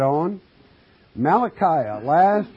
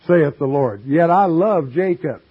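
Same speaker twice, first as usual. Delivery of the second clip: louder, measured pulpit reading; scripture reading; 6 dB louder than usual. Acted. Preacher reading scripture to his congregation, declaiming. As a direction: read the verse out to the flock.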